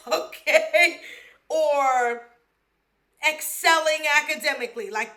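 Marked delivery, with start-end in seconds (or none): 0.0-2.3 laughing. Real, not acted. Laughing about passing out.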